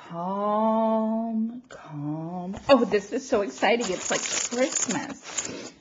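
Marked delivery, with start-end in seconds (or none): 0.1-2.6 long, drawn-out soothing words, sinking lower; self-soothing, easing down softer and lower; calming down. Real, not acted. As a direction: settle yourself after a big breath. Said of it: "I have to take a breath".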